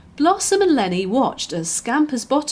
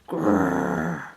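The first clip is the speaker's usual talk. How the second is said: deep puppy growl. Acted. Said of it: "growled deeply".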